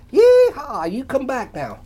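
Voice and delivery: high loud happy holler; yeehaw holler. A narrator's reading. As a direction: let out a big yeehaw. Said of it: "Ray let out a".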